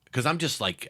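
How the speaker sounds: normal talking voice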